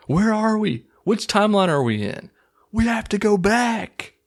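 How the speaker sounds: high-pitched, hammed-up TV desperation; overacted cry of confusion; mock dramatic panic. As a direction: wail it like a lost castaway. Mocks panicked Lost characters.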